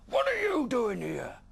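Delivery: slow high mechanical dragon voice; harsh metallic dragon. Acted. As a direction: speak like a clanking machine monster. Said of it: "in a harsh, metallic voice".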